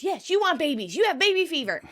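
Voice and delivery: accusing. Retold or imitated. Chandler arguing Monica wants babies, accusing. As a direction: throw it back at her insistently. Acted accusation in couple's quarrel.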